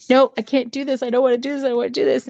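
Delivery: put-on high-pitched pleading girl; frightened refusal. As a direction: protest in a high, scared child voice. Girl refuses to give soul.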